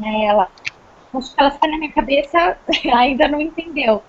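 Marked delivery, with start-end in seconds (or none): none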